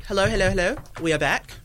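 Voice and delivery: ordinary talk, nothing marked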